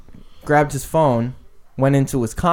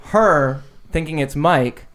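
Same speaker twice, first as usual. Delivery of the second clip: normal talking voice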